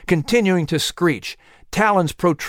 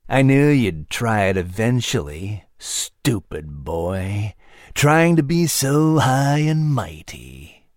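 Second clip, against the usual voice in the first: put-on low, slow sneering beast voice, sinking lower on stupid boy; menacing panther; 5 semitones lower, slower than usual. A narrator's reading. Panther mocking the climbing boy, slow and low. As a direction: drag out the insult like a cat toying with prey. Panther keeps taunting Patrick.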